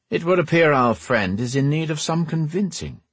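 effusive greeting in a slightly put-on rogue voice; suave rogue. Voices Bane in the story.

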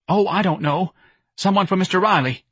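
high, anxious voice; flustered office manager. A narrator's reading. Flustered office manager answers boss.

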